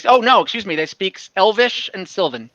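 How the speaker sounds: usual speaking voice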